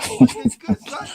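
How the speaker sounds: put-on playful New York street-fan accent. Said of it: "my New York accent right now".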